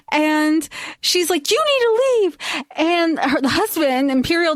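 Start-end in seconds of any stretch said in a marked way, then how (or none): 1.5-2.4 high-pitched mock yell, little added volume; half-voiced yell, pitched way up; retold yelling. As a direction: imitate a distraught mother's order. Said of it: "she just keeps yelling".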